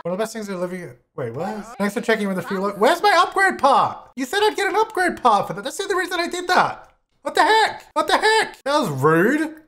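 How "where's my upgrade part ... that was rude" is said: indignant outrage. Real, not acted. Demanding the promised game reward.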